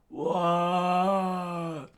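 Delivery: high, loud drawn-out cry of shock; startled yell. Acted. Henchmen seeing Chopper charge at them, startled yell. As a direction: yell it in alarm.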